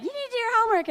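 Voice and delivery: high nagging mom voice. Retold or imitated. Mother scolding her lazy teen, high and nagging. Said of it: "my mom would be like".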